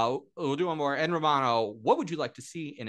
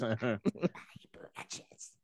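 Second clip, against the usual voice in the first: slow, whispered, creepy Gollum hiss; 7 dB quieter, much slower than usual. Acted. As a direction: breathe it like Gollum. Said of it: "Golem voice lines from the movie".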